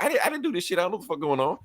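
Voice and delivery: high, protesting denial; flustered Luthor. Retold or imitated. Luthor denying blame to the accusers, high panicked protest.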